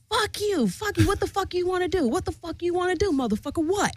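high, rapid-fire mock challenge; hammed-up high-pitched taunting; retold confrontation. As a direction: act out squaring up, pitched high without shouting. Acting out her own face-off.

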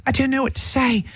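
high-pitched, hammed-up British accent. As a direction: do a plaintive British impression. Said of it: "this is my best British accent".

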